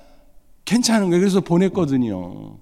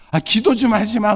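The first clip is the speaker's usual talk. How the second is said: joking son; 8 semitones higher, 4 dB louder than usual. Retold or imitated. Son joking about prayer.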